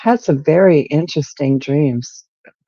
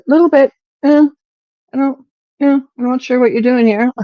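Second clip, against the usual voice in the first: high, louder, uncertain grumble at a guide; doubtful mock complaint; 10 semitones higher, 4 dB louder than usual. Little girl questioning Jesus on the rough trail, higher and doubtful. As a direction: pitch it way up like a puzzled little girl.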